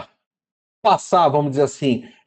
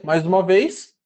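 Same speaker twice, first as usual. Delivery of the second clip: usual speaking voice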